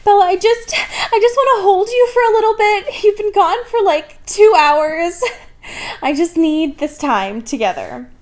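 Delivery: very high, whiny mock sob; hammed-up clingy pleading; mock crying Edward. Edward begging Bella to hold him, very high and mock tearful. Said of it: "like, crying".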